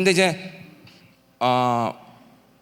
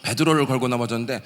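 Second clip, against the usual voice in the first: normal talking voice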